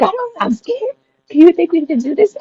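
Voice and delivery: high, squealed scared plea; imitating a frightened cry for reassurance; retold fear. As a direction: act out panic in a squeaky high voice. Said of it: "I remember getting scared".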